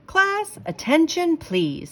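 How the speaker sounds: high, loud teacher call; calling class. Acted. Principal calling class to attention, loud.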